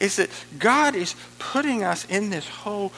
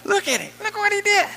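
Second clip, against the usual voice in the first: high, loud mock amazement; amazed onlooker; 11 semitones higher, 5 dB louder than usual. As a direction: gush like a dazzled bystander. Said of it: "you go".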